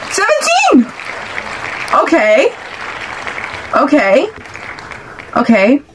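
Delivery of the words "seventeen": high, thrilled call of the number; excited shout of seventeen. Real, not acted.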